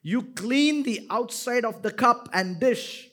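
ordinary talk, nothing marked

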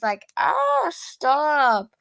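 high giddy squeal; excited squeal. Real, not acted. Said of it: "I need to stop screaming like that".

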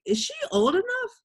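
lightly mock the liquor store guy, way up high; imitating the clerk. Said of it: "looked at me and was like".

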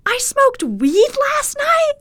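loud, high, drawn-out disbelief; re-enacted incredulous shriek; imitated shocked outburst. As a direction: squawk it in slow, stunned disbelief. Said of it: "I just hear you go".

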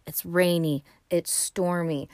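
usual speaking voice

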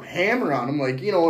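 ordinary delivery

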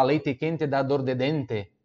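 Paraná accent, slow, hard "te" and "de" with full final E. Shown as a demonstration. Teacher showing how Paraná says it, Paraná accent. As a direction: say "leite quente" with crisp unsoftened "te" endings, Paraná style. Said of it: "Por que que no Paraná fala".

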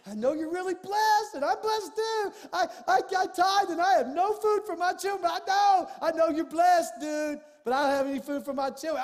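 high, loud pleading that builds to a shout; hammed-up hint-dropping to a rich friend; mock begging. Pastor mocking begging a wealthy doctor, high pleading shout. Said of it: "I didn't go to somebody I knew had more money".

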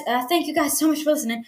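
ordinary talk

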